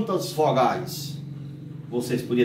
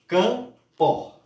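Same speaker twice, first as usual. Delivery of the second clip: CAMPO as higher CAM, pause, PO; split into syllables; 6 semitones higher than usual. Shown as a demonstration. Teacher explaining two voice impulses, split word. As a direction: sound out "campo" in two impulses, first one raised. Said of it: "em dois impulsos".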